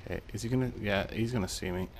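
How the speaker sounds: ordinary talk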